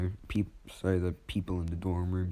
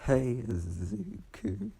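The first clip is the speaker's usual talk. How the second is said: slow, higher put-on voice; slow higher greeting to Izuku at the door; lightly voiced greeting; 6 semitones higher, much slower than usual. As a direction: drawl his name slowly.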